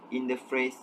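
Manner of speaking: ordinary delivery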